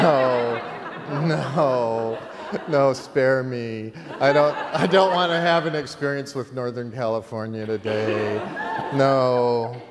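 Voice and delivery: loud, laughing no, no, spare me; laughing protest. Retold or imitated. Begging the strange guest to spare him, laughing. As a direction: plead off the weird guy through laughter.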